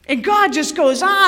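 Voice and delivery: ordinary talk, nothing marked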